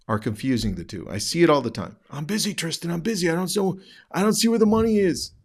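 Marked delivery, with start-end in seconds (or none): 2.1-5.3 high-pitched, hurried mock complaint; raised mocking voice of an overwhelmed client; put-on agent voice. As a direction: imitate a busy agent complaining in a silly high voice. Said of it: "why I'm making that voice".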